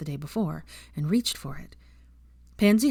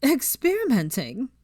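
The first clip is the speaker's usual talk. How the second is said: slow, higher, gleeful mock question; mocking repeat; 7 semitones higher, slower than usual. A narrator's reading. Pansy repeating Hermione's confession to mock her, gleeful. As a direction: savour the word with glee. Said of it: "repeated Pansy with a cackle".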